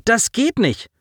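high, appalled refusal; indignant objection. A narrator's reading. Officer to commander, objecting indignantly. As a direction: protest against the order. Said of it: "empörte sich Nell".

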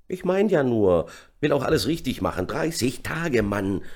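high-pitched gleeful exclamation; cheerful calling. A narrator's reading. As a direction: chirp it happily to a mate. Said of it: "rief er vergnügt". (vergnügt gerufen)

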